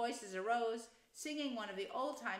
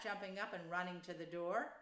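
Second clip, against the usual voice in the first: usual speaking voice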